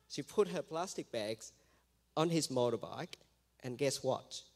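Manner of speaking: usual speaking voice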